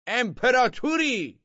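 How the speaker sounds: high, slow loud cry; crowd shouting. A narrator's reading. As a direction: shout it up together. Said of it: "فریاد زدند".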